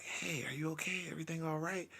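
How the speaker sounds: very soft, hushed voice; gentle check-in. Retold or imitated. Boyfriend leaning close to a drunk woman, hushed concern. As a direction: lean in and ask quietly. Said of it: "I get closer to Jess".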